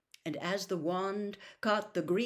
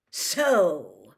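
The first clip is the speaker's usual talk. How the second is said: loud, drawn-out gloating; savage triumph; 3 semitones lower, 9 dB louder than usual. A narrator's reading. Official looks down on the accused elf, savagely triumphant. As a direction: land it heavily, as if you've won. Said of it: "with a kind of savage triumph".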